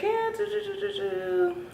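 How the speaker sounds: high hummed mock-casual do-do-do; imitating the sonogram lady.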